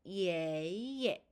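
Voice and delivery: 예 drawn out rising, then a short dropped 예; long climbing 예, quick low 예; second tone plus neutral tone. Shown as a demonstration. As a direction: glide up on the first 예, let the second fall briefly. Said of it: "뚝 소리가 조금 더 낮아지면서".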